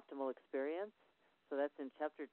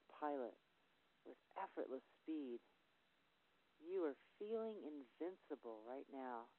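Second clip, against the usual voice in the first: guided visualization read; 5 dB quieter than usual. Shown as a demonstration. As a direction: talk the athlete into the zone, quietly pressing each "you". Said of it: "I emphasize the word you".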